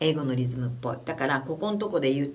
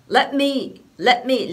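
"let me" with a quick clipped "let", louder; short let; 4 semitones higher, 5 dB louder, much slower than usual. Shown as a demonstration. Teacher demonstrating clipped English rhythm, a bit louder. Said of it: "letの音は短いです".

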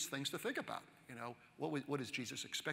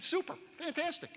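much higher, chirpy eager reply; mock enthusiasm; 13 semitones higher, 3 dB louder than usual. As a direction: act out an over-keen yes to the girlfriend's father. Acting his eager reply to the father.

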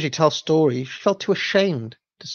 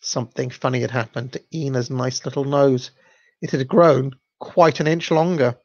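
plain reading voice

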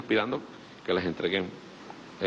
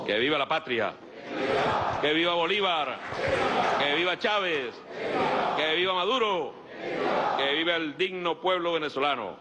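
ranting dictator speech; 4 semitones higher than usual. Acted. Rally chants closing speech.

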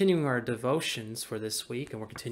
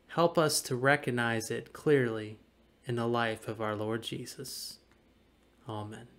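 prayer; 4 dB louder than usual. Acted. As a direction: pray reverently, dropping away at the end. Closing prayer after "Let us pray.

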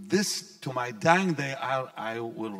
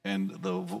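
ordinary delivery

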